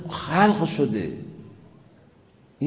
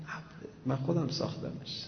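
much softer, confiding voice of the maker; hushed divine voice; 3 semitones lower, 11 dB quieter than usual. Pretend. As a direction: drop to a near hush, speaking as the creator. Voicing God softly.

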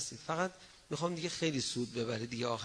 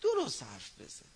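stern rebuke; 3 semitones higher than usual. Shown as a demonstration. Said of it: "او ببین".

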